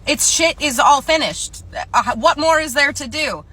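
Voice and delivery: loud, high, drawn-out indignation; outraged disbelief. Acted. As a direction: protest it loudly, word by word. Acted outraged coworker arguing.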